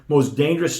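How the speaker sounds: usual speaking voice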